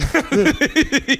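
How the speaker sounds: high burst of laughter. Real, not acted. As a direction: laugh out loud. Laughs at robber's defeat.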